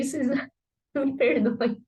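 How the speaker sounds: usual speaking voice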